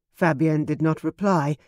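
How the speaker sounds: ordinary delivery, nothing marked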